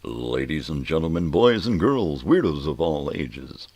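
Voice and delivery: very loud ring announcer, swooping up high. Pretend. Host hyping boys, girls and weirdos, booming ring-announcer voice. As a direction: belt it out to the whole arena. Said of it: "a ring announcer".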